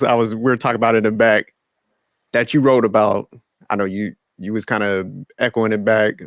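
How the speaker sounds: ordinary talk, nothing marked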